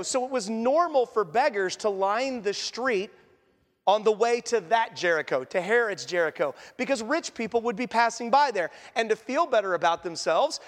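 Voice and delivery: normal talking voice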